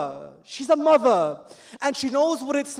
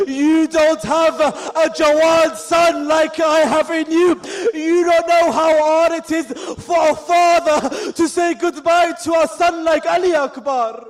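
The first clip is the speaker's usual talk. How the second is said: louder, slowed, sobbing cry of a grieving father; crying shout; 2 semitones higher, 4 dB louder than usual. Acted. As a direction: wail it out as a father losing his son. Father's anguished farewell to his son.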